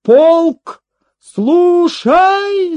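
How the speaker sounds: high, loud, drawn-out military order; shouted command. A narrator's reading. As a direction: bellow it to the whole regiment. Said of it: "закричал солдат". (зычная протяжная команда)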